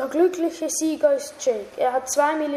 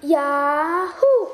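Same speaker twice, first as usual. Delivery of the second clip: excited; 4 semitones higher, 5 dB louder than usual. Acted. Jake cheering about the trip.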